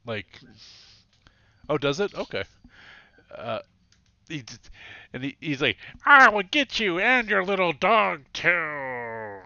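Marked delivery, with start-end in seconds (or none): 6.1-9.4 higher, louder, slow cartoon villain snarl; a hammed-up animated baddie threatening the little dog; Scooby-Doo bad guy voice. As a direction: ham it up like a seventies cartoon villain. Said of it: "he's got that Scooby-Doo bad guy voice".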